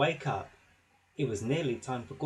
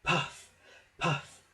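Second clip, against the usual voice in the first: panting; 3 semitones higher than usual. A narrator's reading. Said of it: "panted poor Thomas".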